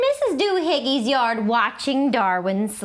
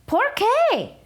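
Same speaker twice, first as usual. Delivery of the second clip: puzzled question; 13 semitones higher than usual. A narrator's reading. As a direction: ask it in surprise. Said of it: "asked Skipito".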